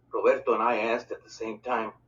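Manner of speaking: ordinary delivery, nothing marked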